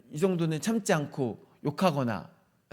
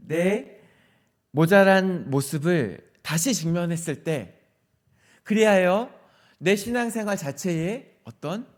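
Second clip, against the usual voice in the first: normal talking voice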